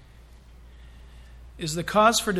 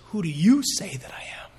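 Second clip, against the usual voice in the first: whispered question; 3 semitones lower than usual. Acted. Jesus's question voiced in sermon.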